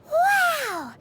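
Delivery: an awed little wow; amazed wow. Acted. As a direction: gasp it out like a kid hearing a legend. Paimon marvels at the Adepti.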